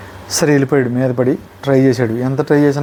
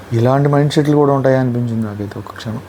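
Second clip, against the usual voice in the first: ordinary talk, nothing marked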